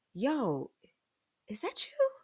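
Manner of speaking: suspicious question. Retold or imitated. Boyfriend notices the smell.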